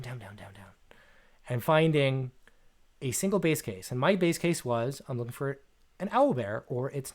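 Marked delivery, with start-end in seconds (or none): 0.0-1.0 much lower and softer, down to a whisper; fading to a whisper. Shown as a demonstration. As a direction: let each down sink lower until whispered. Recursion going deeper.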